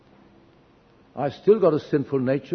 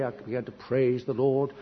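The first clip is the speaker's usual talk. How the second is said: ordinary delivery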